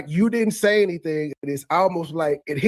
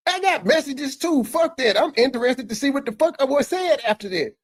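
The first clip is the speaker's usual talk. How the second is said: imitating him in a much higher voice; hammed-up higher mimic of a man getting riled; mock him, pitched up and indignant; 9 semitones higher than usual. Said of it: "was like".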